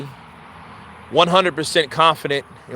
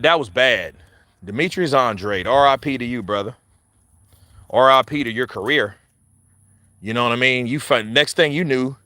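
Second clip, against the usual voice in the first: ordinary talk, nothing marked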